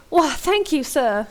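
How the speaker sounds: high, loud mock Southern belle; hammed-up Southern belle thanks; Southern Belle accent. A narrator's reading. Riley thanking a friend for a hand aboard, feigned Southern Belle accent. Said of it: "feigns a Southern Belle accent".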